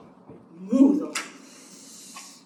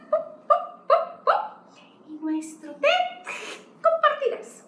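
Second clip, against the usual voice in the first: squeaky mouse. Acted. Reading to a child, the mouse comforts the bear squeakily. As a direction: squeak it like a kind tiny mouse. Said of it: "el ratón chilla".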